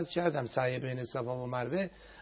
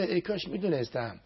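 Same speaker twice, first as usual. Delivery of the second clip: ordinary delivery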